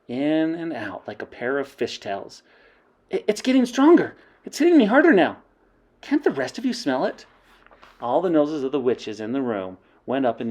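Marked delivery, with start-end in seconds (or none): 3.1-7.2 high, urgent, rising alarm; excited witch. Acted. Mildred urging the witches, excited. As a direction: press the others to smell it.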